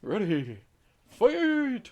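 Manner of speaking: high shouted Ready, fight; booming fighting game announcer. Pretend. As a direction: call the round start like an arcade game.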